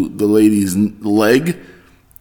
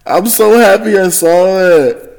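higher, louder, slow delight; excited; 8 semitones higher, 4 dB louder, slower than usual. Real, not acted. Vlogger thrilled after watching the flop video. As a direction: savor the joy of the clip.

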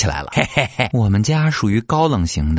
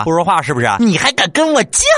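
mock mother losing her temper, pitched way up; angry parent; 8 semitones higher than usual. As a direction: shout it up high at the stubborn kid. Parent escalating at silent child.

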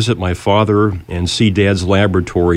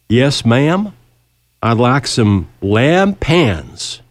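slow, drawn-out Louisiana drawl, imitated; heavy Louisiana accent; 4 semitones higher, much slower than usual. [name] orders from the waitress in a thick Louisiana accent. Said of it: "heavily accented way of speech".

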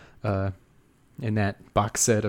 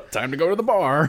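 brisk, higher chipper voice; cheerful drunk; 7 semitones higher, faster than usual. Retold or imitated. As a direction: sound eager to hit the bar. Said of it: "and then he's like".

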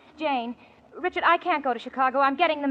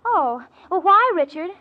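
usual speaking voice

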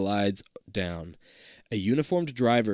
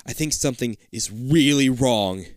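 ordinary delivery

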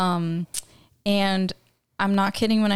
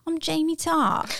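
lightly mimicking Jamie Tart's British accent, higher; a playful stab at a British accent, pitched up; British accent imitation; 8 semitones higher than usual. Fan imitating Jamie Tart's British accent for her cohost, lightly. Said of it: "he's always like".

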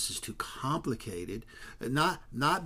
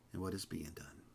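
whisper; 5 semitones lower, 12 dB quieter than usual. Real, not acted. Dropped to a whisper.

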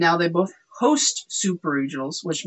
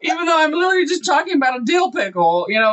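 laughing; 9 semitones higher, faster than usual. Real, not acted. Still laughing at the innuendo.

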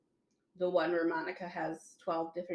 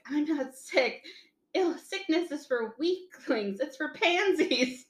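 retold Monica; 7 semitones higher, slower than usual. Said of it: "And she's like".

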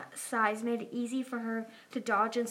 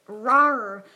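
loud drawn-out "rawr"; pretend roar; 11 dB louder than usual. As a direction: roar like someone playing dragon. Sunny play-roars as the queen.

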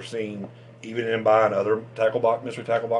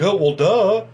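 loud, high mocking "duh"; exaggerated sarcastic retort, pitched up; 9 semitones higher, 7 dB louder, slower than usual. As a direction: imitate a friend making fun. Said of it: "continued to make fun of me".

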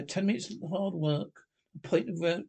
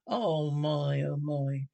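miserable; much slower than usual. A narrator's reading. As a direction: lament it with a touch of misery. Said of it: "said Mole miserably".

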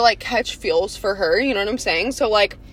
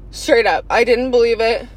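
ordinary talk

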